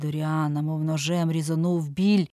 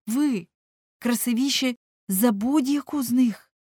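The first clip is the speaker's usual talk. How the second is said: loud cries of the name, then urgent higher-pitched plea; alarmed pleading; 5 semitones higher, 5 dB louder than usual. Acted. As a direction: plead with him in dismay. Painter pleads after suicide threat. (схвильоване, тривожне благання)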